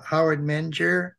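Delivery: normal talking voice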